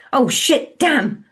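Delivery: loud, furious swearing; angry cursing. A narrator's reading. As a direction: spit the curses out. Banging hand in anger.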